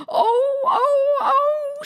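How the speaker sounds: high, loud wailing oh oh oh; shouted oh oh oh. Acted. Queen wails over her finger, high loud oh oh oh. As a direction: wail like a steam whistle. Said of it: "shouted the Queen".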